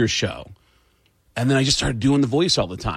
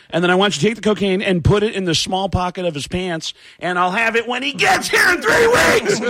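Sam Kinison impression; 6 semitones higher than usual. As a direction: rant the absurd request, building to a yell. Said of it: "it's Sam Kinison".

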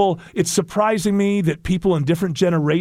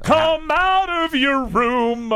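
higher, slow dramatic call; stern parent; 9 semitones higher, much slower than usual. Acted. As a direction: play the stern mother. Mock musical scene parent.

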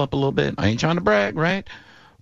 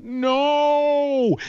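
shocked no. Retold or imitated. Disbelief at Brady retiring.

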